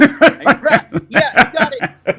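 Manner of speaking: high, loud burst of laughter through the words; laughing. Real, not acted. Cohost laughing at the Aziz joke. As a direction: laugh it out, barely able to speak.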